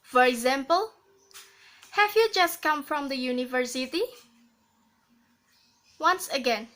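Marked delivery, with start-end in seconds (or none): 1.9-4.0 quick question rising at the end of "university"; rising intonation. Shown as a demonstration. Teacher showing a rising question, quick, "university" going up. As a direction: lift the pitch on the tail of "university". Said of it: "rising intonation".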